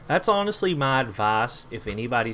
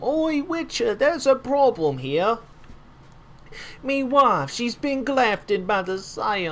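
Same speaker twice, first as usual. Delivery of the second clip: hammed-up Italian-British NPC voice, much higher and louder; a pleading quest-giver in a silly Italian-British accent, pitched way up; comic half Italian, half British villager; 11 semitones higher, 5 dB louder, slower than usual. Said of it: "I made him half Italian and British".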